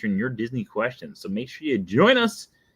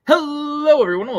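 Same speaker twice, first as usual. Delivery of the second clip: much higher, louder hello; booming high hello; host greeting; 7 dB louder than usual. Acted. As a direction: belt the show opener. Loud host opening.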